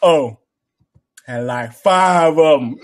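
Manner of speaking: a slow, boastful reply; Spice Adams bit. Retold or imitated. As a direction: drag it out like a proud brag. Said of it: "he does it when they're".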